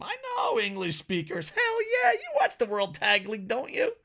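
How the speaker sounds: rally the English fans in a squeaky shout; mock hype.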